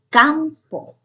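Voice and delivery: "campo" with CAM high and drawn out; teacher's high-pitched "CAM-po"; stress on CAM. Shown as a demonstration. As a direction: hit the first syllable of "campo": CAM-po. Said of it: "el acento en cam".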